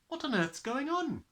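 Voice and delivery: put-on high, alarmed girl's cry. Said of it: "she cried".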